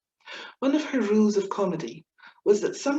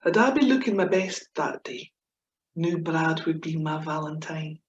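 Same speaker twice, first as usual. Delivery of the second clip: lively comic verse reading; poem recitation; 4 dB louder than usual. Acted. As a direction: perform the poem with gusto. Reciting her comic Scots poem.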